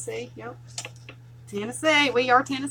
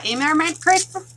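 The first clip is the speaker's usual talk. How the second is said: scolding mom calling full name; 6 semitones higher, 4 dB louder, faster than usual. Retold or imitated. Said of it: "I can just see her saying that".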